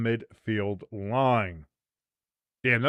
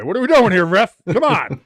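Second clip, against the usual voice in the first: loud, higher-pitched protest; exasperated complaint; 5 semitones higher, 8 dB louder than usual. Real, not acted. Fan-commentator complains to ref about corner, exasperated shout.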